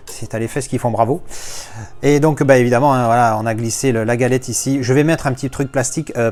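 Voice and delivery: normal talking voice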